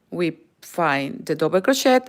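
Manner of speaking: usual speaking voice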